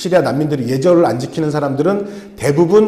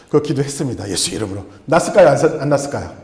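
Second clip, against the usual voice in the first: ordinary talk, nothing marked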